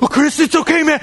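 high, panicky imitated pleading; scared classmate. Scared kid placating Chris in class, imitated panic. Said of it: "that guy looked scared".

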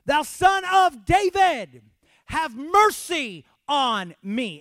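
high, drawn-out shouted plea; yelling. Acted. Blind Bartimaeus begging Jesus for mercy, yelling. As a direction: bellow it slowly, begging to be heard. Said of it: "He yelled it".

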